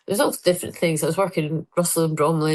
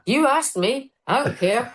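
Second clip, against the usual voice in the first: Ricky Gervais quip; 6 semitones higher, slower than usual. Retold or imitated. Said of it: "and he went".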